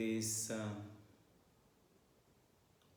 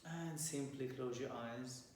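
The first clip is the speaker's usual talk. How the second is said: softer, unhurried meditation voice with long pauses; guided meditation; 2 semitones lower, 8 dB quieter than usual. Acted. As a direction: guide listeners calmly into their breath and posture.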